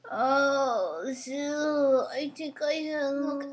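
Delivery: ordinary talk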